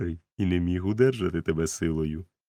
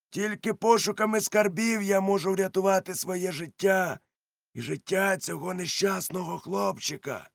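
much higher pirate voice, swearing an oath; Silver the pirate cook; 12 semitones higher than usual. A narrator's reading. Silver swears to the doctor, much higher and insistent.

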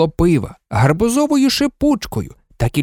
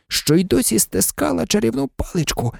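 ordinary delivery

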